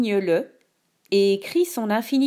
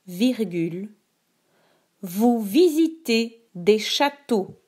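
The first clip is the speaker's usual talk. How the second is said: slow, clear dictation voice; dictating; slower than usual. Shown as a demonstration. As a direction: read each word slowly for dictation. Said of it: "Écoute attentivement la dictée".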